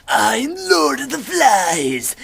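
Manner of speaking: high, very loud, stretched monster proclamation; demonic pig's head. Retold or imitated. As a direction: roar it like a hammy villain. Pig's head speaks, acted.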